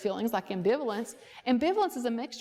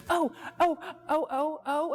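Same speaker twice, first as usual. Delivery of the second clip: eager volunteer; 5 semitones higher than usual. Retold or imitated.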